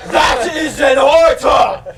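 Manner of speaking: furious high shouted command; angry shout. Acted. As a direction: bellow the order like an enraged king. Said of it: "he just shouted".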